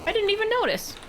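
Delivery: high, surprised exclamation. Real, not acted. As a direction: catch it with surprise. Surprised at the carved face.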